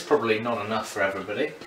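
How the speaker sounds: ordinary talk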